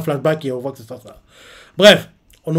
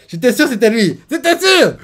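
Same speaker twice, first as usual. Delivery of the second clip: high, louder cry of triumph; triumphant; 8 semitones higher, 4 dB louder than usual. Real, not acted. Viewer celebrating that his guess about Dragon was right. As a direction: crow that you called it.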